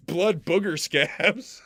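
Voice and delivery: drawn-out mock-savouring, very slow, laughing; slow laughing repeat. Streamer repeating his gross phrase for chat, slow and laughing. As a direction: relish each word slowly while laughing. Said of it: "you don't have to say it like that".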